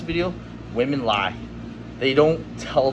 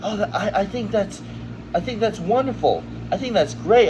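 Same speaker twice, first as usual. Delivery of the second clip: higher, gushing imitation of the dates; imitating a date gushing that it's wonderful, pitched higher; mock girl voice; 7 semitones higher than usual. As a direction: chirp it like an over-eager girl. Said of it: "And they were like".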